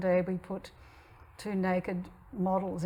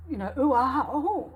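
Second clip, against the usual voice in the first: impressed gasp; 5 semitones higher, 5 dB louder than usual. Retold or imitated. Impressed shopper voiced, laughing.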